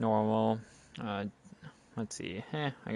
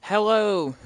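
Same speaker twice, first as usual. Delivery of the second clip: high, loud greeting; bright loud hello; 10 semitones higher, 7 dB louder than usual. Shown as a demonstration. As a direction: call out hello to chat. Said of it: "You said hello".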